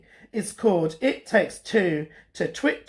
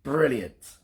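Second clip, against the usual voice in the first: plain reading voice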